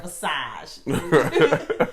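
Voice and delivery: loud, laughing New Jersey accent. Shown as a demonstration. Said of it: "I tried".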